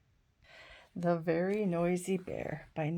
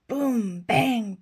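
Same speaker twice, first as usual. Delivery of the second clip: drum sounds; 4 semitones higher, 9 dB louder than usual. Acted.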